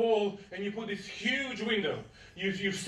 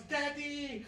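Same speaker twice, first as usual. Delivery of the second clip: hushed, high mock shout to Daddy; little girl calling; 6 semitones higher, 6 dB quieter than usual. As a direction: call out like a little girl, drawn out but soft. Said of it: "and she shouts".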